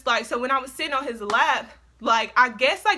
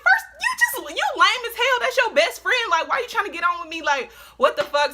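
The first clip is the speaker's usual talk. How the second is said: much higher, louder indignant rant; mock furious telling-off; 8 semitones higher, 3 dB louder than usual. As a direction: tear into him, outraged and shrill-pitched. Retold telling-off of suitor.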